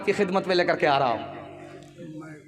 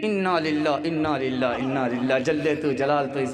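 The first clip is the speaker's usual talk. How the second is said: mock mourning; 6 semitones lower than usual. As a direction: lower and lower, a hammed-up lament. Daughter mourns about mother-in-law.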